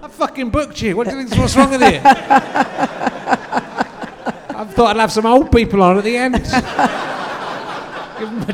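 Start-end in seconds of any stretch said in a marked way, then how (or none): 0.0-2.0 high, laughing mock indignation; laughing outrage. Real, not acted. 2.0-4.5 high, breathless wheezing laugh; laughing fit. Real, not acted. Bursts out laughing. 4.5-6.3 louder, high teasing through laughter; laughing tease. Real, not acted. Laughing jibe at guest. 6.4-8.1 high wheezing laugh, breathless; laughing fit. Real, not acted. Laughing again.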